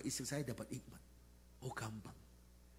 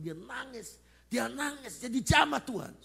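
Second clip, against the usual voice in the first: building higher and louder; rising fervor; 12 semitones higher, 9 dB louder than usual. Real, not acted. Pastor tells church man wept, rising fervor. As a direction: lift the congregation as you build. Preaching climax about crying.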